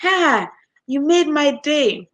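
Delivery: high, loud gushing thanks; overjoyed customer. Retold or imitated. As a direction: gush with delight like a grateful caller. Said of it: "And they're like".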